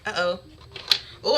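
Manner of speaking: normal talking voice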